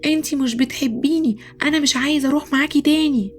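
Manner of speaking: high-pitched angry protest, acted; childish yelling. Boy yelling at his mother in the supermarket, high angry protest. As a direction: play an upset child's tantrum, pitched high. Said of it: "وبدأ يزعق".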